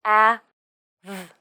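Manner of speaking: "H-A-V." as three separate sounds, h, a, v. Shown as a demonstration. Teacher breaks down the word have, sound by sound. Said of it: "Pay attention to the final V sound".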